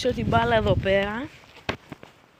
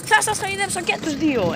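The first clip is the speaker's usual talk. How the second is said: high, exasperated promise to both dogs; high-pitched pleading; 8 semitones higher, 3 dB louder than usual. Real, not acted. Raised voice over excited dogs.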